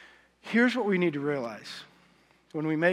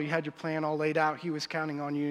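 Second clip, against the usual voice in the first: usual speaking voice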